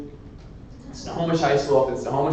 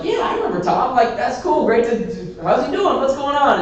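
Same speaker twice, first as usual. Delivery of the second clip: much higher, bright eager questions; 10 semitones higher than usual. Retold or imitated. Speaker asking Annie about Tom, high and eager. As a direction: chirp up high, keen to hear. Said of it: "I'm like".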